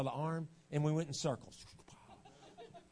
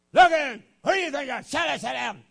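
much higher, louder scolding yell; imitating a yelling father; 12 semitones higher, 15 dB louder than usual. Preacher mimics a father screaming at his kids, yelling. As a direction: bellow at the kid like an angry dad. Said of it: "daddies scream and holler at their kids".

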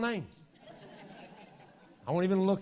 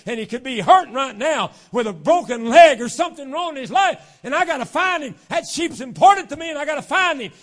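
urgent shepherd; 6 semitones higher, 12 dB louder than usual. Acted. Preacher as shepherd searching for his lost sheep, mounting urgency. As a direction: start at usual pitch, build to loud, high urgency. Acts desperate shepherd, building.

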